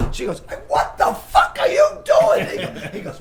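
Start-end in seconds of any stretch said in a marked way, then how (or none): 0.7-2.5 high, loud, drawn-out shout; retold shriek of outrage; outraged yell. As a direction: holler it like someone scandalized, hammed up. Acted outrage at a caught boss.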